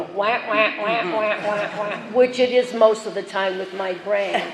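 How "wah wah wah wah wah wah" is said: muffled trombone-like wah wah, lightly done; Charlie Brown teacher. Retold or imitated. For the group, a hint of the Peanuts teacher wah wah. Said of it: "like Charlie Brown was reading".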